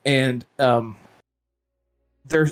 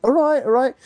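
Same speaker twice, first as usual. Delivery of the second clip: mock British accent; 10 semitones higher than usual. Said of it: "And he's like".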